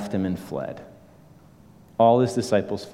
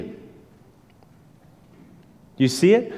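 ordinary talk

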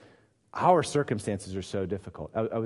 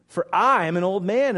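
put-on "For I am an old man", with "I" emphatic; stress on I; 8 semitones higher, 8 dB louder than usual. A narrator's reading. Zechariah answering the angel, emphatic "I". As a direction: lean hard on "I", raised and louder. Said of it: "the I is emphatic".

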